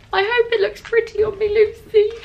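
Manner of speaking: squeaky, gushing to a best friend; high little cutesy squeal. Real, not acted. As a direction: pitch it way up, sweet and silly. Gushing to friend about gift.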